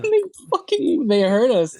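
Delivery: sudden high, loud burst; excited outburst. Real, not acted. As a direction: blurt it out in disbelief.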